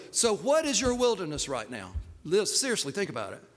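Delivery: ordinary delivery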